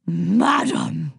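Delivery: admiring, hearty god voice; rolled-out flirtatious salute; booming admiration. A narrator's reading. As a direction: savour the word admiringly. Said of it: "Zeus boomed admiringly".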